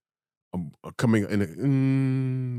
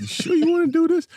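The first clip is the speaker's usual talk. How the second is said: put-on high, loud doubtful old man; imitating grandfather; 5 dB louder than usual. Grandfather doubting his grandson's marriage, high and loud imitation. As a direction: mimic a grandfather's worried warning. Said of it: "that was my grandfather".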